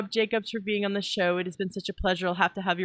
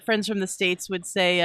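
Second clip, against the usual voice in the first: ordinary talk, nothing marked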